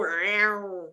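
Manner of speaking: drawn-out "reow" big-cat meow; imitated cougar meow. Showing how she thought cougars sound, cat yowl. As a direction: mimic a cougar's long yowling meow. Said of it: "Do they not go".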